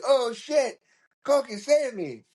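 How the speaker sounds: high-pitched cry for rescue; mock panicked plea. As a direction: imitate a friend yelping for help. Friend grabbed, calls for help.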